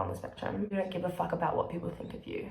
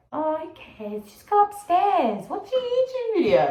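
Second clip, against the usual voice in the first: very high, loud, drawn-out put-on voice; tempting inner voice; 12 semitones higher, 8 dB louder than usual. Inner voice luring student from studying, mock carefree. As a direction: squeal it like a carefree procrastinator.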